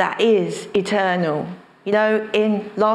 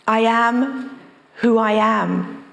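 slow solemn; slower than usual. Acted. Preacher repeats name slowly.

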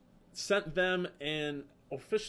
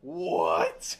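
lower, loud stunned "What?"; 5 semitones lower, 7 dB louder than usual. Retold or imitated. Fan stunned the band knows him, loud disbelief. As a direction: blurt it out in disbelief. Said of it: "And I'm like".

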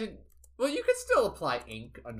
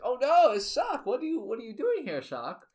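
put-on high, flustered German-accented greeting; 9 semitones higher, 3 dB louder than usual. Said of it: "they're gonna have a German accent".

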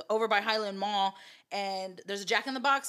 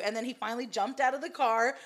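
normal talking voice

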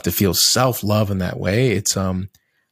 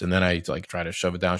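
ordinary delivery

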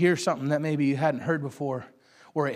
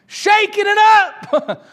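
drawn-out high shout, much louder; climactic shout; 12 dB louder, much slower than usual. Real, not acted. Preacher crying out to the church, slow and loud. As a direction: belt it out slowly like a climax.

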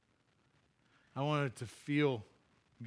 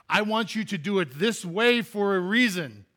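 louder, higher commanding voice of God; God speaking; 6 semitones higher, 7 dB louder than usual. Acted. God making a point to His people, firm. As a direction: declare it with authority.

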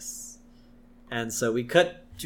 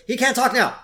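1 brusque Homer; 9 semitones higher, 5 dB louder than usual. Retold or imitated. Homer grabbing the phone.

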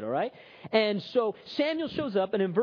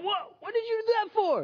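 high, startled squeal; shocked bartender. Acted. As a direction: yelp it out, splashed in the face. Bartender shocked by thrown water.

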